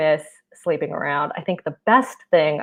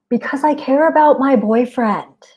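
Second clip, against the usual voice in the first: higher, sweetly innocent little voice; mock innocent; 6 semitones higher than usual. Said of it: "stand my ground and be like".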